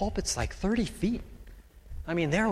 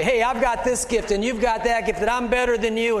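higher, louder mock boast; proud boasting; 6 semitones higher, 5 dB louder than usual. Pastor mocking a proud churchgoer's boast, hammed-up and smug. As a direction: puff up like a show-off. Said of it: "Let's not get proud and say".

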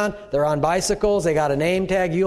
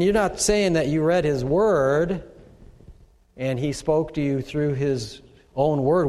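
ordinary talk, nothing marked

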